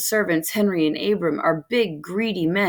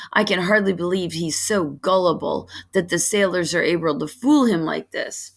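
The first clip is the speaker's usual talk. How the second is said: ordinary delivery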